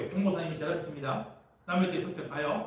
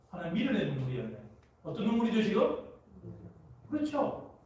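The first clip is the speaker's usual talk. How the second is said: usual speaking voice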